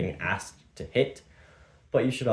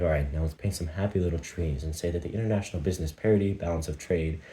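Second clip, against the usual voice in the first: lower, slowed-down soothing painter voice; slow, calm level; 4 semitones lower than usual. Shown as a demonstration. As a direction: ease way down like painting happy little trees. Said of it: "I could bring it to this level".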